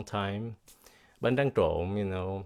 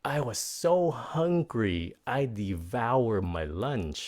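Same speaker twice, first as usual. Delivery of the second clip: slow example sentence; 2 semitones higher, slower than usual. Shown as a demonstration. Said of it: "các bạn đoán được không".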